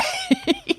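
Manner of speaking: very high, soft giggle; high laugh. Real, not acted. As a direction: squeal softly with laughter. Joking about her own nagging question.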